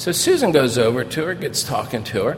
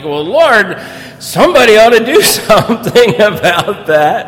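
louder and higher, a laugh breaking in, "do" rising; half-amused, knowing the answer already; grinning plea to the Lord; laughing retold prayer; 9 semitones higher, 9 dB louder than usual. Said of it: "and I'm like".

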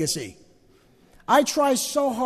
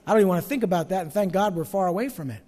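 ordinary talk

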